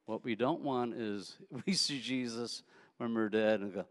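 ordinary delivery

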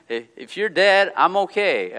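slow, loud, high laughing aside; laughing joke. Pretend. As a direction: tease him through a laugh. God joking to Elijah, laughing.

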